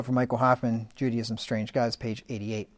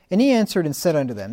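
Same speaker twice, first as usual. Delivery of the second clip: louder, brisk Bible reading; scripture reading; 4 semitones higher, 7 dB louder, faster than usual. Acted. Preacher opens Jesus' words to listeners, declaimed scripture reading. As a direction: read the verse out, raised and declamatory.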